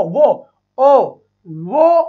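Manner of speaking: वो and ओ held with round lips; rounded o sound. Shown as a demonstration. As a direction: model the ओ vowel clearly. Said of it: "ओ की sound आनी चाहिए बेटा". (गोल होंठों से ओ की आवाज़)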